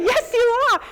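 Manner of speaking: very high and loud "Yes, you ARE."; emphatic repeat. Retold or imitated. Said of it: "I said".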